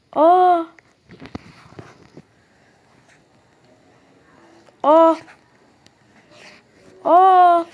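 loud startled exclaim; surprised gasp. Real, not acted. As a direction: yelp in sudden delight. Finds a secret room.

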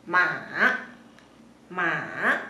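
"Ma" low, then curving up; dipping third tone. Shown as a demonstration. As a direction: let "Ma" sink before it climbs. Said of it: "de bajo y sube".

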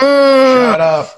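high, loud shout through laughter; a loud, high yelp of laughing disbelief. Real, not acted. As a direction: blurt it out loud, cracking up. Laughing outburst at the story.

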